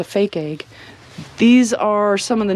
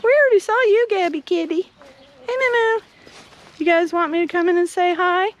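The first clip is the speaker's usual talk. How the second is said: pet talk; 9 semitones higher, faster than usual. Real, not acted. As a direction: squeak it up high to the animals. Greeting cat and chickens.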